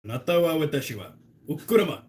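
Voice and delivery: lightly put-on anime Japanese voice; mock anime voice, slowed down. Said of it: "my anime voice".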